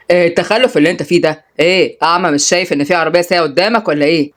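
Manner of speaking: ordinary delivery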